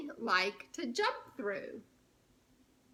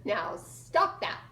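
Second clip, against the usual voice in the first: slow, firm, louder; a touch of stern owner; mild scolding Mister Putney; 3 semitones lower, 7 dB louder, slower than usual. Acted. Mister Putney scolding the spider, slow and firm. Character line in picture book.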